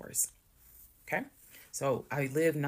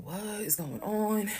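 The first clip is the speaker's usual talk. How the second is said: high, drawn-out puzzled question; baffled neighbors; 7 semitones higher, much slower than usual. Retold or imitated. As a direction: play the gawking neighbors, stretched out slow. Neighbors staring at the arrest.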